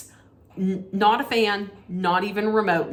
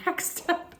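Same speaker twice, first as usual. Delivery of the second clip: breathy laugh through the words; giggling, can't get it out; 10 semitones higher, 7 dB quieter than usual. Real, not acted. Vlogger laughing while announcing the next award.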